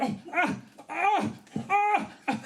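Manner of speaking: high, acted yelps of cramp pain; hammed-up pained "ah"s; mock cramp groans. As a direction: cry out as if the leg just seized. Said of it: "I feel a cramp on my leg".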